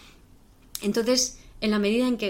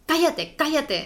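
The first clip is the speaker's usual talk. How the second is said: mock-irritated, higher, slow hushing of a crying child; impatient parent; 6 semitones higher, 4 dB louder, slower than usual. Parent silencing a crying child, imitated impatience. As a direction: play the fed-up parent telling the kid to shut up.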